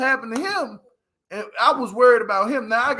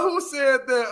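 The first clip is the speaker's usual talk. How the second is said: usual speaking voice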